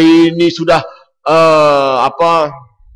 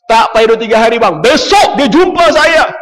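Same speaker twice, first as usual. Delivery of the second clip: excited reveal; 9 semitones higher, 6 dB louder than usual. Real, not acted. Preacher delighting his audience, excited. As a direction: savour the surprise ending.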